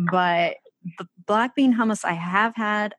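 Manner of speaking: ordinary talk, nothing marked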